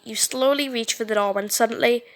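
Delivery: ordinary delivery, nothing marked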